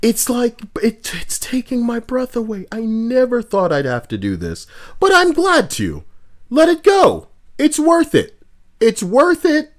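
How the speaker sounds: high, overwrought voice of noble sacrifice; mock dramatic martyr. Streamer hams up sacrificing himself to play the game, overwrought and high.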